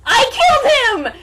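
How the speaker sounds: villain boasting to his dad; gleeful shout. Acted. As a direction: shout the confession with glee. Villain confessing a murder.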